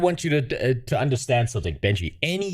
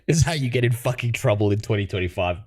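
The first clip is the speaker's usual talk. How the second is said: ordinary talk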